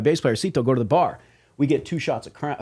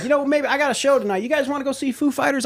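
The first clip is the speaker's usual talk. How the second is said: much higher, casual put-on offer; boss offering; 13 semitones higher than usual. Label president inviting band, much higher casual offer. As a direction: tempt the band with a show. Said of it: "he's like".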